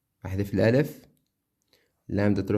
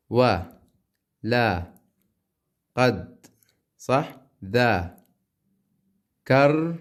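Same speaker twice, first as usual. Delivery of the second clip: loud, drawn-out syllables one at a time; 5 dB louder, much slower than usual. Shown as a demonstration. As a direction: chop the line into slow syllables. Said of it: "هلا الواو عندها تروح مع الراء". (تقطيع مقطعي بصوت عالٍ)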